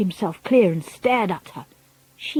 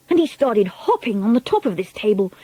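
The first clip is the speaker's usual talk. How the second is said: ordinary delivery, nothing marked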